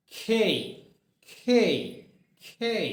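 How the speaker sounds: খেই, said with a strong puff of air and an ay glide; aspirated letter name. Shown as a demonstration. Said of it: "not কে". (জোর বাতাসে খেই, কে নয়)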